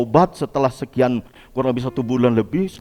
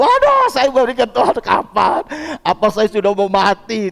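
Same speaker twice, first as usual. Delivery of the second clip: high cry of alarm, easing into anxious questions; acted panic; 8 semitones higher, 4 dB louder than usual. Patient asking God if death is near, acted panic. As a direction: wail in mock dread, then question God. Acts out own fear of dying.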